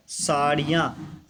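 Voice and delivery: साड़ियाँ drawn out slowly, syllable by syllable; slow model word. Shown as a demonstration. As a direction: slowly spell out साड़ियाँ for the class. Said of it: "sari का साड़ियों नहीं होता है".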